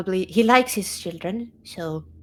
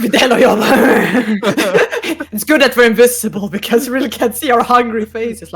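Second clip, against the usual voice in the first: laughing; 2 semitones higher, 11 dB louder than usual. Real, not acted. Laughing about hungry faces.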